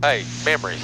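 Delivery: ordinary talk, nothing marked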